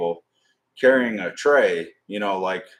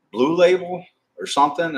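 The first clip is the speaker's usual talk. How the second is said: waitress voice; 9 semitones higher, 4 dB louder than usual. Retold or imitated. Waitress voiced in retold story.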